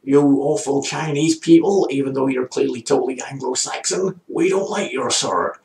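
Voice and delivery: put-on poor American accent. Cowboy insulting a Chinese man in a saloon, mock American accent. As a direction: play the awful cowboy, American accent not quite landing. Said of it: "That's not really an American accent".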